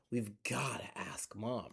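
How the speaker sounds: whispered aside. Acted. Storyteller whispers the secret to the kids. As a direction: lean in and whisper it.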